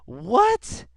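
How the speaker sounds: ordinary delivery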